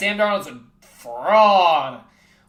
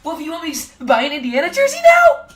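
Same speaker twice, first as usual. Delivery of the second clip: higher, put-on offended voice; mock indignation; 8 semitones higher, 6 dB louder, faster than usual. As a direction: play it outraged for laughs. Mock indignant reply to Wolfie.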